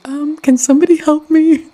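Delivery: high-pitched, bewildered fifties-person impression; confused fifties time-traveler. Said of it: "This guy's confusion reminds me of, like, if somebody from the fifties".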